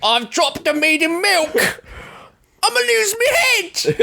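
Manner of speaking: very high, panicked cockney peasant. Acted. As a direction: squeal it like a frantic peasant. Old-timey tavern bit.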